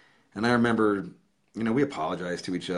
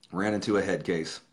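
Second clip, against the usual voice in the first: usual speaking voice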